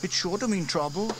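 put-on high boy voice, unsure; young boy James shrugging it off. A narrator's reading. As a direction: voice the young brother. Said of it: "said James".

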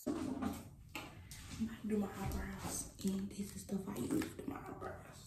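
very softly, under the breath; hushed whisper. Real, not acted. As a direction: keep your voice down for sleepers. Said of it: "'cause people sleep".

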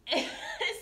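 high burst of laughter; real laugh. Real, not acted. Nurse laughing while telling viewers about coworkers. Laughing at her own story.

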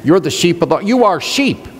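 ordinary talk, nothing marked

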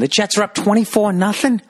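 high-pitched incredulous sports-fan cry; mock outrage. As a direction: blurt the score out high, in exaggerated disbelief. Acts out reacting to the football game.

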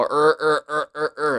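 repeated English er trapped in the throat; throaty er sound. Shown as a demonstration. Teacher isolating the vowel of burger, throaty er. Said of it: "ese sonido tan atrapado en la garganta".

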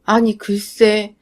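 plain reading voice

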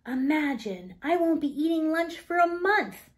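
put-on a little girl delighted at the idea; young Layla. A narrator's reading. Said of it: "replied Layla with a twirl".

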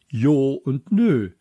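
slow mocking Jo und Nö; drawn-out Jo und Nö, mimicking the boy; sloppy Jo and Nö. A narrator's reading. Teacher mimics the boy's slang Jo und Nö, slowly. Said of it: "heißt es Ja und Nein und nicht".

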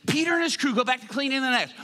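usual speaking voice

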